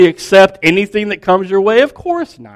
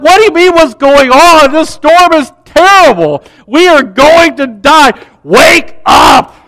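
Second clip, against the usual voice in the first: high, loud frantic pleading that ends in a shout; panicked disciples; 6 semitones higher, 4 dB louder than usual. Retold or imitated. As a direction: yell at Jesus, desperate to wake him.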